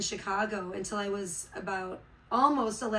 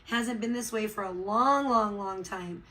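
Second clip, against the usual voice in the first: ordinary talk, nothing marked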